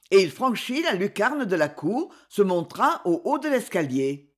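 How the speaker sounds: usual speaking voice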